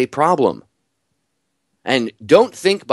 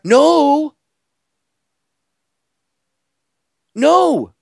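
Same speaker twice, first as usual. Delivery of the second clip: sharp high No, much higher and louder; emphatic shout; 6 dB louder than usual. Real, not acted. Host on air cuts off the myth with a loud shout. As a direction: shout No at the room.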